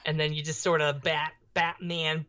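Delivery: usual speaking voice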